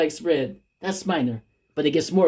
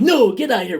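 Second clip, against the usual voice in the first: louder, higher order to leave; telling off; 7 semitones higher, 6 dB louder, faster than usual. Retold or imitated. Rob ordering his ex out, loud and high. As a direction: throw her out sharply.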